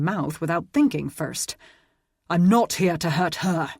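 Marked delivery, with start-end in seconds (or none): 2.3-3.7 a mild thunder, slightly louder. A narrator's reading. Roland answering Aidan's threat, mild thunder. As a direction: boom the denial at Aidan. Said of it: "Roland thundered".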